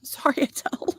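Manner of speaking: breathy laugh through the words. Real, not acted. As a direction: apologise while laughing.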